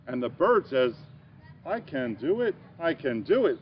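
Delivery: put-on lively picture-book read-aloud for kids; reading to children. Teacher reading a picture book to young children, bright and lively. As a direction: read it like story time for little ones.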